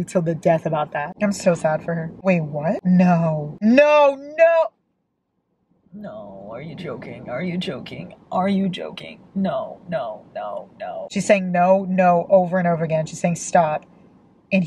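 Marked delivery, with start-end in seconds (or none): 3.6-4.6 high, loud cries of disbelief; horrified protest. Real, not acted. Shocked by a scene in the book. 5.9-11.1 hushed, low, shocked murmur; stunned disbelief. Real, not acted. Stunned by the scene she reads.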